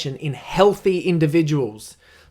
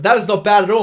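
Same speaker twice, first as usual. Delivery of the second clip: much higher and louder, leaning hard into it; emphatic approval; 7 semitones higher, 10 dB louder than usual. Retold or imitated. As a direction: sell it like the mate really means it. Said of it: "He said".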